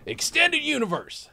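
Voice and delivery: high, loud Texan boasting; Texas accent. Acted. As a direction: brag like a Texas tycoon. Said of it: "moved to Texas and got accents".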